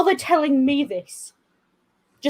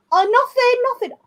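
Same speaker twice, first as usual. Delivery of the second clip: much higher, sing-song fake innocence; innocent nothing; 11 semitones higher than usual. Ring answering from the shower, high and mock-innocent. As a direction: play it like a guilty kid.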